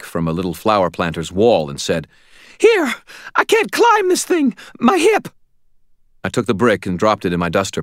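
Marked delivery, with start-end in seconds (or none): 2.6-5.3 very high, raised landlady voice. A narrator's reading. Landlady handing over a brick, high-pitched protest.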